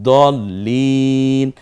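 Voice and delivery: দলিন with a doubled, held ল, very slow; slow stretched দলিন; doubled consonant. Shown as a demonstration. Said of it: "দুইবার উচ্চারণ করতে হয়".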